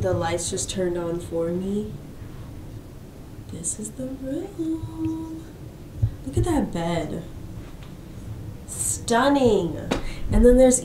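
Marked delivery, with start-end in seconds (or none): 3.5-7.2 slow, hushed whisper; awed whisper. Real, not acted. Showing viewers the bedroom, slow awed whisper. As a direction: reveal it softly in awe. Revealing hotel room.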